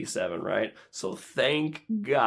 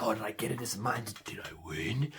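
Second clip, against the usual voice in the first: soft, low murmur; anxious hush; 4 semitones lower, 10 dB quieter than usual. Acted. Bidder waiting for results, anxious hush. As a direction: ask it under your breath, sinking lower.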